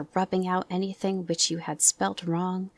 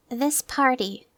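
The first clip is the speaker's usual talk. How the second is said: Christopher Robin's voice; 7 semitones higher, 4 dB louder, slower than usual. Acted. Christopher Robin addresses party guests.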